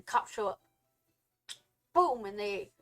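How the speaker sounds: normal talking voice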